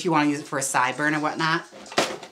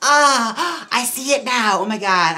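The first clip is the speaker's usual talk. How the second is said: loud, raised, excited gasp of delight; thrilled reaction; 8 semitones higher, 7 dB louder, slower than usual. Real, not acted. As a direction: exclaim with real excitement, high and loud. Sees the raid alert on screen.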